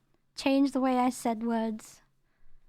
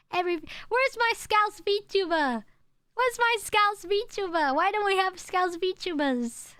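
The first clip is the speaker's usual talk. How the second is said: mock whine; 7 semitones higher than usual. Real, not acted. Whining for a Scouse VTuber.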